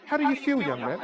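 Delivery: lightly put-on inquisitive music teacher; teacher's probing question. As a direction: question the pupil from on high, softly landing. Voices the teacher questioning him.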